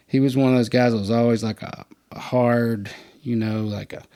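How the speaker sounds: usual speaking voice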